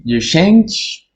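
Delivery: usual speaking voice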